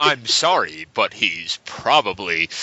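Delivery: bad acting. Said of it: "this acting is terrible".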